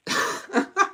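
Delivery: breathy laugh. Real, not acted. Laughs after the stunt.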